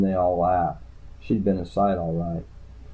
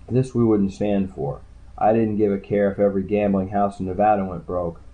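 ordinary delivery, nothing marked

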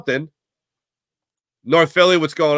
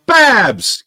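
loud, high yell of a name; calling out; 10 semitones higher, 8 dB louder than usual. Real, not acted. Host hailing a chatter, sudden high shout.

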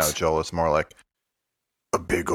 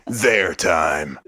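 usual speaking voice

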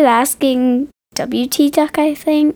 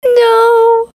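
sad cat voice; 11 semitones higher, 3 dB louder than usual. Acted. Cat's reply voiced by friend.

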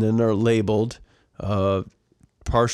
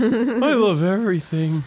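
mock indulgent; 8 semitones higher, slower than usual. S1 playing up her indulgent side.

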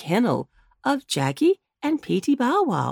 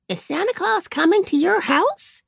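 put-on higher small boy's voice; little boy asking; 10 semitones higher than usual. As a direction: ask his friend hopefully. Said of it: "asked one boy".

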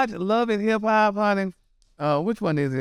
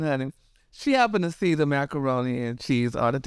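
ordinary delivery

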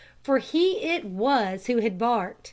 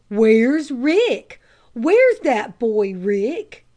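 louder, slow, pleading dog voice; eager dog's voice; 7 dB louder, much slower than usual. A narrator's reading. Dog searching for his master Rick, eager loud plea. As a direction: voice the dog begging for its boy. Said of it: "Ruddy seemed to be saying".

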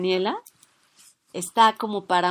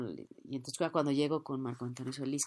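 ordinary talk

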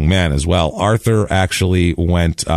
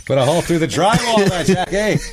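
a higher mock Boston wife scolding Jackie; hammed-up New England accent, pitched up, nagging her husband; New England yell; 10 semitones higher than usual. Wife scolding husband over drywall, in a high mock New England accent. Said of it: "yelling at each other in a heavy New England accent".

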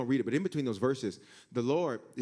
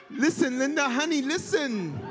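laughing coaxing voice; 10 semitones higher, slower than usual. Acted. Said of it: "He's like".

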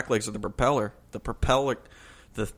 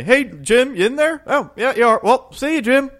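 high, loud, singsong call through a door; hammed-up cheery hello to a friend in the stall; exaggerated buddy greeting, pitched way up and loud; mock friendly greeting; 13 semitones higher, 6 dB louder than usual. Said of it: "Just say".

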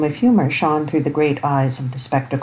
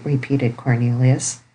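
ordinary delivery, nothing marked

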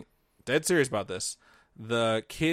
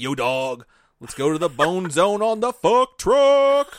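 slow, high, loud dudebro come-on; bro voice; 5 semitones higher, 7 dB louder, slower than usual. Retold or imitated. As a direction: ham up a horny bro inviting his wife. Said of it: "Mark was probably like".

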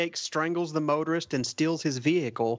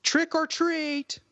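high, slow mock shout of a fed-up lady; mock yell; 13 semitones higher, much slower than usual. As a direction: stretch it out like a frustrated woman at the door. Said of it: "end up yelling".

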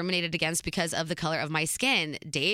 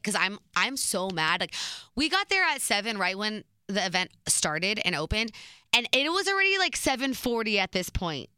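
normal talking voice

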